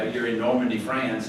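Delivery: normal talking voice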